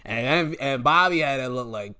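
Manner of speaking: usual speaking voice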